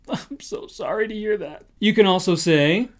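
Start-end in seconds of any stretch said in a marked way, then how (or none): 0.1-1.6 soft, pained pouty "I'm so sorry to hear that"; exaggerated sad-face sympathy. Shown as a demonstration. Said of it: "you have to make that face too".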